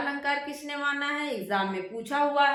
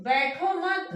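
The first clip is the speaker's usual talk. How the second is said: बैठो मत higher, as one unit; मत joined to बैठो; 6 semitones higher, 3 dB louder, slower than usual. Shown as a demonstration. Teacher shows the other reading, बैठो मत kept together. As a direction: run बैठो straight into मत and stop. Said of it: "इसी को आप ऐसे भी कह सकते हैं".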